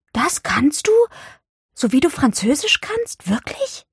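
high, incredulous questions; stunned. A narrator's reading. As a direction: marvel in disbelief, pitch climbing. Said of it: "sagte sie fassungslos". (ungläubig staunend)

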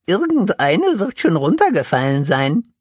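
hohe, lautere Figurenstimme, zuversichtlich; Pinsel, hoffnungsvoll. A narrator's reading. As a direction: Pinsel sucht eifrig nach Früchten. (hohe Pinsel-Stimme, hoffnungsvoll)

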